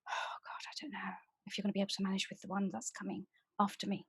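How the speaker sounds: fast, anxious whispered aside; a nervous hurried whisper before the other one arrives; frightened whisper. Acted. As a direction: whisper it quickly, as if warning the audience. Frightened aside before next persona.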